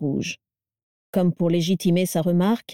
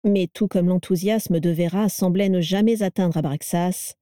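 plain reading voice

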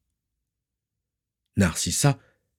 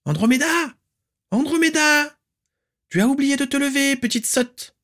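shrill aunt's call. Acted. Aunt Walburga banging on the door, shrilly yelling. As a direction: screech the niece's name through the door. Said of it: "la voix stridente de la tante Walburga".

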